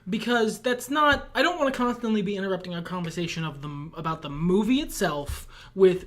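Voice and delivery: normal talking voice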